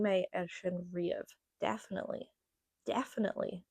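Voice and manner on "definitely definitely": soft, tentative American "definitely"; attempted American accent. Shown as a demonstration. Trying to sound American, soft careful attempt. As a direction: try "definitely" like an American, quietly. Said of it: "Trying to say like an American now".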